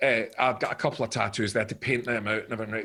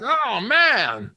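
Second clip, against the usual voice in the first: loud, high, thrilled director; over-excited impression, pitched way up; John Landis; 13 semitones higher, 7 dB louder, much slower than usual. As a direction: enthuse loudly, imitating the director. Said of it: "He went".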